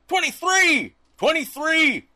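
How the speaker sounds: high, loud repeated yell. Retold or imitated. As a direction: holler each count way up high.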